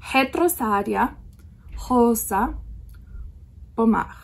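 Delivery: Retrosaria Rosa Pomar in separate words, R's like H; Portuguese R's. Shown as a demonstration. Vlogger repeating the brand name for viewers, Portuguese H-like R's. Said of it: "it's more like a h sound".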